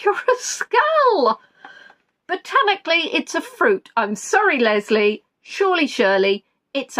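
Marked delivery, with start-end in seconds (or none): 0.0-1.4 high slow laughing jab; laughing tease. Real, not acted. Host teasing a player, laughing and high. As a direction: giggle the insult out slowly.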